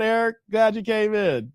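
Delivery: ordinary talk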